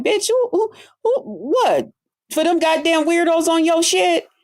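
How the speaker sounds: sudden high-pitched incredulous who who. Real, not acted. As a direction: shoot up in pitch in disbelief. Disbelieving outburst at rival.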